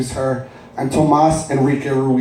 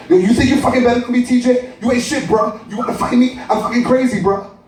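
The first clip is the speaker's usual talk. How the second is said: high, aggressive street voice; older brother barks at his mother and sibling, high and aggressive; tough older brother; 9 semitones higher than usual. Acted. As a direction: pick a fight, all bravado. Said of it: "And here comes Duncan".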